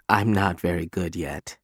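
soft, sad low admission; mournful. Acted. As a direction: say it sadly and quietly. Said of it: "she adds mournfully".

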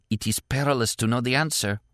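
ordinary delivery

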